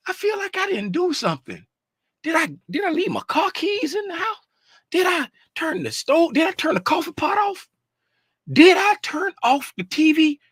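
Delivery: hammed-up anxious self-questioning, high-pitched; mock worry. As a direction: play nagging doubt in a high, small voice. Acts out own nagging worry.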